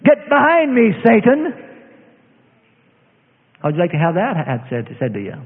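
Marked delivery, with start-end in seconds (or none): 0.0-1.5 loud rebuke of Satan; the Lord answering the showy prayer; stern rebuke. Retold or imitated. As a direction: hit back with a firm rebuke. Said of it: "And the Lord say".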